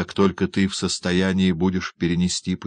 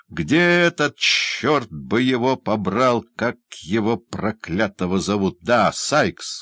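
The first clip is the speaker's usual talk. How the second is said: furious shout; 4 semitones higher, slower than usual. Acted. Doctor shakes the hunchback and yells for Sikes. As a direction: bellow for the villain. Doctor shaking his captive, demanding.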